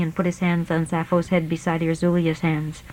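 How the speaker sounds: ordinary delivery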